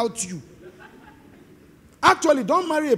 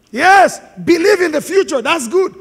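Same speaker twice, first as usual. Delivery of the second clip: loud, raised-pitch exhortation; emphatic preaching; 6 semitones higher, 9 dB louder, slower than usual. Real, not acted. Preacher granting that faith is good, emphatic preaching. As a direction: call it out to the whole congregation.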